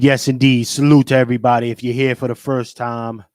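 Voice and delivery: ordinary delivery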